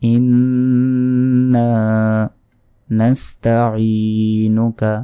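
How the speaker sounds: very slow, drawn-out recitation. Shown as a demonstration. Teacher reciting so viewers memorise the sound. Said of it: "আমি যেভাবে বলছি এভাবেই মুখস্থ রাখবেন".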